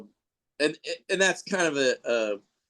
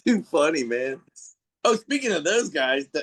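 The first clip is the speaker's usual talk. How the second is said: ordinary delivery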